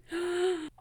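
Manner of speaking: sharp shocked gasp. Real, not acted. Shocked gasp at Michael's plan. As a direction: gasp at the twist.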